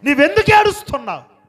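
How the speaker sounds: high, loud preacher's shout; emphatic shout. Real, not acted. Preacher establishing the Lord's question to listeners, emphatic shout. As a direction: fling the question out at full voice. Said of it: "నేను establish చేసి చెప్తున్నాను".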